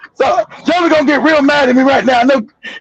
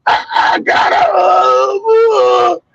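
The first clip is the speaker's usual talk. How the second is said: straining, breathy moans of a man on a feeding tube, pitched higher; imitating a sick friend; 6 semitones higher, much slower than usual. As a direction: mimic a friend who can barely get words out. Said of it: "They gonna take you back with treatment".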